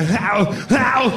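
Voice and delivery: drawn-out mock groan; two long, put-on "how" noises; imitated movie grunt. Making the noise in bed, mock groaning. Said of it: "That's a good noise to make".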